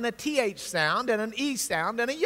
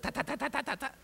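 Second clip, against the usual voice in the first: soft quick imitation of cards clicking on bicycle spokes; clacking spoke noise; 3 semitones higher, 8 dB quieter, much faster than usual. Teacher imitates baseball cards on bike spokes, soft quick clacking. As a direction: tap out the clicking like a card in the spokes. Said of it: "It basically sounds like".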